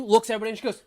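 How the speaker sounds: ordinary talk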